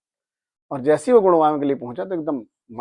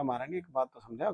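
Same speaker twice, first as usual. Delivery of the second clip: hushed reverent plea; 13 dB quieter than usual. Retold or imitated. Devotee softly addresses the goddess.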